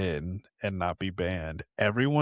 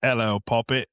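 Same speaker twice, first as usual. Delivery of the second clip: louder, hammed-up British greeting; fake British accent; 6 dB louder than usual. American abroad faking it to blend in, British accent. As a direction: put on a mock British accent.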